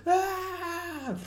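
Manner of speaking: very high, sustained scream at normal volume; held-back high wail; long mock scream. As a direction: imitate an endless movie battle cry. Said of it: "ten, fifteen seconds of".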